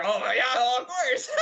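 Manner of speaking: loud, hammed-up gushing; mock eager agreement. Co-host teasing the husband about his answer, mock eager agreement. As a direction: mimic him agreeing, loud and climbing high. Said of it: "He's like".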